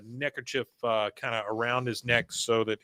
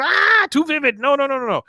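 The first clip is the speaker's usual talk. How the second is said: high, loud mock yell of horror; horrified protest; 13 semitones higher, 7 dB louder than usual. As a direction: cry out, then a rapid string of protests. Con recoils at mother's romance.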